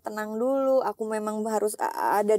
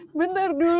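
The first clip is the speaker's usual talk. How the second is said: tearful plea; 6 semitones higher, 4 dB louder than usual. Retold or imitated. Mother crying to daughter.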